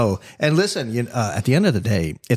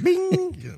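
a sharply higher sung bing like an email alert; mimicked notification ping. Co-host imitating incoming email alerts, a high ping. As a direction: chirp it like a phone ping.

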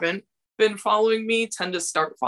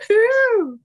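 high loud woohoo cheer; excited whoop; 7 dB louder than usual. Real, not acted. Cheering at racy photos.

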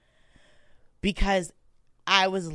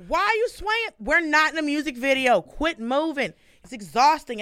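exasperated scolding; 8 semitones higher, 5 dB louder than usual. Retold or imitated.

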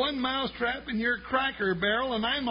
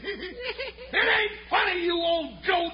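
indignant shout; 6 semitones higher, slower than usual. Acted. Angry after the prank.

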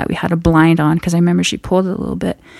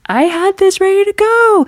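mock mom voice; 12 semitones higher than usual. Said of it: "She's like".

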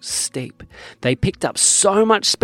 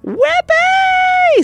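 long high joyful squeal; whooping; 6 dB louder than usual. A narrator's reading. Josie squealing with joy as she lies back, high and loud. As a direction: squeal it out with glee. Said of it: "said Josie as she laid down".

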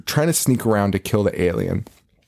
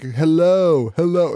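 higher, pushy hello hello; mock-coaxing hello, pitched up; impatient mock greeting; 6 semitones higher, slower than usual. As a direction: nudge someone to speak up. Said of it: "they're like".

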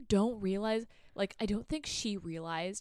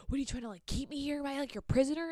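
higher, mock-indignant questioning; 6 semitones higher than usual. Man accusing her of keeping him prisoner, indignant. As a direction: fire off the accusation in a raised pitch. Acted accusation in retold quarrel.

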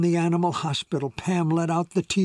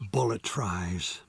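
plain reading voice

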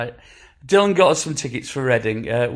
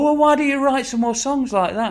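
much higher-pitched mock questioner; imitated questioning; 11 semitones higher than usual. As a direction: put on a high voice for a fan's question. Voices people's naive question.

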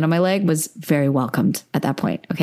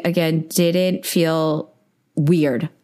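slow, weighty emphasis on every word; emphatic; much slower than usual. Real, not acted. Podcast host telling listeners she felt safe, slow and emphatic. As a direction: spell it out slowly so it lands. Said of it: "I'm saying that emphatically".

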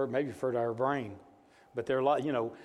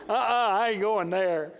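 mock scared kid refusing; half-laughing, pretend kid saying no; playful refusal; 6 semitones higher, 5 dB louder than usual. Retold son's refusal, laughing.

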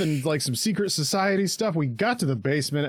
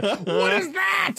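laughing mock shout; 11 semitones higher, much slower than usual. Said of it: "they're like".

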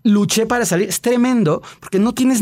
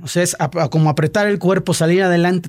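ordinary talk

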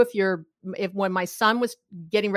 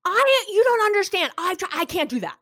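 upset protest, starting much higher; a rising whine of frustration; escalating partner; 9 semitones higher than usual. Retold or imitated. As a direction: partner pushing back, upset and high. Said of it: "They go".

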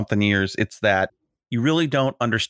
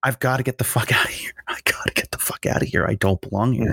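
hushed urgent aside; 2 semitones lower than usual. Retold or imitated. Man confiding to his wife at a crowded table, hushed aside. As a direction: whisper it privately, retelling a wish to escape.

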